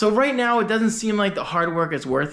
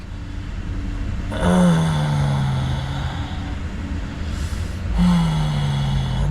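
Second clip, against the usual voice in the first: long drawn-out mock urinal groan; exaggerated bathroom groan. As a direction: imitate a man groaning at the urinal. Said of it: "there's no need to be like".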